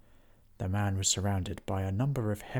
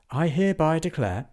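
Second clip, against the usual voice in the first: louder, higher formal declaration; proud announcement; 7 semitones higher, 6 dB louder than usual. A narrator's reading. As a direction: proclaim it to the court. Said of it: "announced Mulch".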